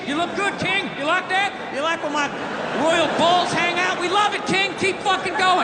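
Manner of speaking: butter up the King like a toady; fawning yes-men. Retold or imitated. Entourage flattering [name], fawning and cheering.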